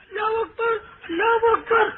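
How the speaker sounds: very high, strained, tearful call to prayer; mock wailing adhan. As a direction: imitate a muezzin who seems to be crying. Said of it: "اذان دے رہا کہ رو رہا". (روتی ہوئی اذان کی نقل)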